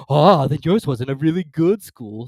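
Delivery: slow, loud relieved sigh. Acted. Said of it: "in a tone of great relief".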